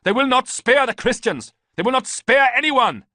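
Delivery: loud, high furious warning; furious yelling. A narrator's reading. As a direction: yell the warning at the traitor. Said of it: "Ballista yelled".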